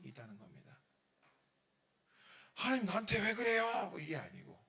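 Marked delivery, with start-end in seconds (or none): 2.5-3.9 loud, high mock protest to God; angry complaint. A believer protests to God, loud angry complaint. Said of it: "화가 나거나 내 마음속에".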